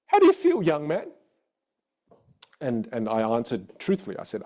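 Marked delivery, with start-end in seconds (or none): 0.1-1.1 music teacher's question. Retold or imitated. Teacher turning round from the piano to her student, mimicked question. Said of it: "she turns around and she goes".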